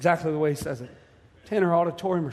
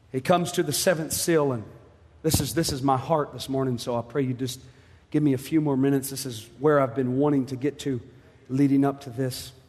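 usual speaking voice

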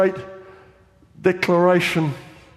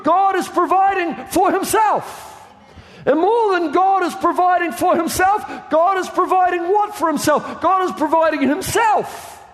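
high, ringing preacher's shout; fiery preacher declaring, slowed for weight; preaching at full force; 8 semitones higher than usual. Acted. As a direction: build the congregation up to a climax. Preaching climax.

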